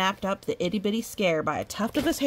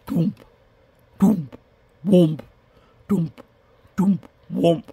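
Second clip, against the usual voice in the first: loud chanted bass; 8 dB louder, slower than usual. Acted. Mimicking spooky music.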